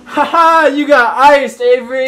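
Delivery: loud teasing yell; gleeful shout. Real, not acted. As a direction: shout it in triumph. Pranking friend with ice.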